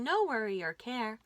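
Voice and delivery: plain reading voice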